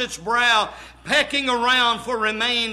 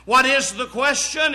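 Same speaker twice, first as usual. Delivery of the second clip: ordinary talk, nothing marked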